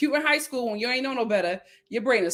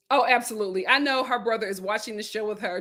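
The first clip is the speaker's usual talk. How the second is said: normal talking voice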